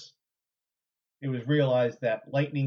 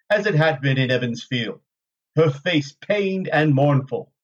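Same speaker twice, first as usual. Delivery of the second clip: louder, measured audiobook narrator; story narration; 3 semitones higher, 10 dB louder than usual. A narrator's reading. Narrator reading a chapter to listeners, dramatic storytelling. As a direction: read it out with deliberate dramatic phrasing. Reads novel chapter aloud.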